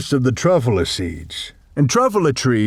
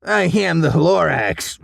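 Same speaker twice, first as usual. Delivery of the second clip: Lorax voice; 5 semitones higher than usual. A narrator's reading.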